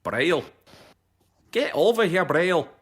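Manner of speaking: loud, high mock-Scottish call with tapped R; Scottish accent. Parent calling a child named Braille, in a rough Scottish accent. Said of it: "turned on the Scottish part of my accent brain".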